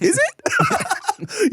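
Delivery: mock surprise. Host mimicking his clueless reply to the abuse comment, mock surprise. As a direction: feign innocent surprise. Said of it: "And I'm like".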